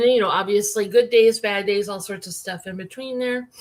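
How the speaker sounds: usual speaking voice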